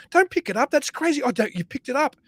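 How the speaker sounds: very high, pleading warning; mock alarm. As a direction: fake horror at the pickup. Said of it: "we're going, like".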